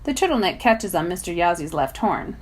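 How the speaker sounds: ordinary delivery, nothing marked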